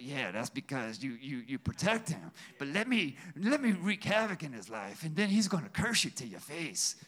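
low, rumbling demon growl. Retold or imitated. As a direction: drop deep and sinister as Satan. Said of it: "That's my demon voice".

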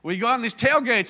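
ordinary delivery

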